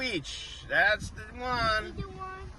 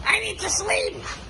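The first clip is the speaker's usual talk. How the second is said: quoting Mama; 9 semitones higher, slower than usual. Retold or imitated. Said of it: "She just said".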